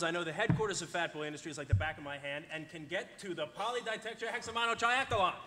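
ordinary delivery

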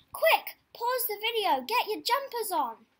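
a bright mock-urgent warning to listeners; urgent playful call. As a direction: hurry the kids like the wind is coming. Reader's playful urgent aside.

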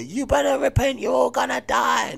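high shouted hellfire warning; mocking fire-and-brimstone yell; mock street doom preacher. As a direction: ham up a roadside doom-monger. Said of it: "people that stand on the roadside and say".

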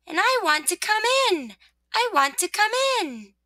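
high, eager little plea; cheery little voice. Acted. Sun pleading with rosebud to come in, cheery. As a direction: pipe up cheerily, pitched high. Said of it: "said the cheery little voice".

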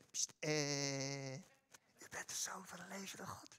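hushed, secretive whisper; mock whisper. Peter mock-whispering his confession to Jesus, hushed whisper. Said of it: "ik geloof gewoon niet dat Petrus dat gefluisterd heeft".

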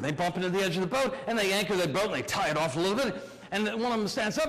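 ordinary delivery

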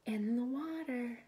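usual speaking voice

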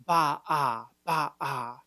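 ba, ah pulsed in one breath; throat stop drill. Shown as a demonstration. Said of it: "in one breath".

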